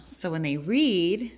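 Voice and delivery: ordinary talk, nothing marked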